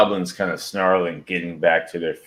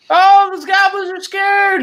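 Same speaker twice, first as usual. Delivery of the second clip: very high, slow mocking voice; echo of the falsetto taunt; 6 dB louder than usual. Game master echoing Super's taunt to Xander in a very high, slow mocking voice. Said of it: "that sounds like Super".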